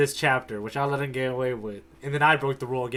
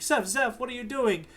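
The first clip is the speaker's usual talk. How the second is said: higher, hurried calling out to a friend; alarmed cooks; 7 semitones higher, 4 dB quieter than usual. Retold or imitated. Cooks calling out to Zeph, alarmed and higher. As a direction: cooks crying out his name in alarm. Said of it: "They're like".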